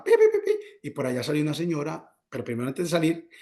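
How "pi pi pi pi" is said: high, loud repeated beeps; imitated bell. Storyteller imitating the shop bell on opening the door, high beeping. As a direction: mimic a door bell in high-pitched pips. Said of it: "empezó la campana a sonar".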